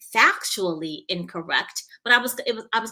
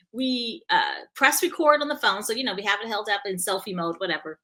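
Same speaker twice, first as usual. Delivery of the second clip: ordinary talk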